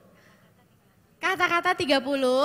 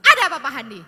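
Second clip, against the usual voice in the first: loud, glaring demand; angry; 11 dB louder, much faster than usual. Shown as a demonstration. Trainer playing table-banging anger at a volunteer, scary and loud. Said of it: "sambil saya gebrak meja ya, sambil saya melotot".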